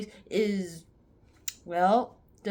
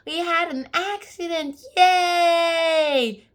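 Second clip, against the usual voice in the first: high, cheering mock kid voice ending in a yell; delighted kids; 10 semitones higher, 8 dB louder, much slower than usual. Kids cheering about the car crash, high and shouted. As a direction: squeal it like thrilled children. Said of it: "the kids are also delighted, saying".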